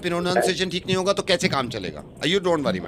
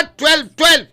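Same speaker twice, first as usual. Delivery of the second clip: high loud drilling of "twelve, twelve"; shouting the answer; 10 semitones higher, 6 dB louder than usual. Retold or imitated. Father drilling his son on six times two, loud shout. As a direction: bark the number at the kid. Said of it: "मैंने कहा".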